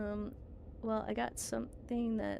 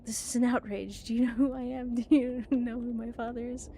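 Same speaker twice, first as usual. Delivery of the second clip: put-on dissolute young heir; 6 dB louder than usual. Spoiled heir protests.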